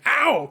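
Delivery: high, loud "ow"; acted yelp of pain. Servant thumped with wood, acted loud pained yelp. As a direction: yelp as if just whacked. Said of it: "comes from one of the servants".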